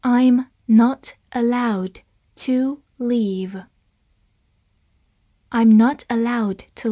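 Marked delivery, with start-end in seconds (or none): 0.0-3.7 very slow, word by word; slow reading. Shown as a demonstration. Teacher models the passive sentence for learners, slow reading. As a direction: read slowly, separating each word. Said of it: "好，慢慢来读".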